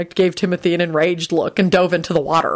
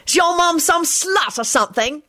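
very high, sneering taunt; mocking; 12 semitones higher than usual. A narrator's reading. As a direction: needle the boy mockingly. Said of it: "called out in a mocking voice".

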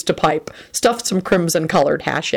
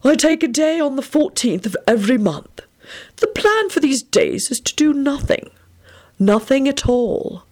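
put-on old headmaster voice; 9 semitones higher than usual. A narrator's reading. Dumbledore speaking to the boys.

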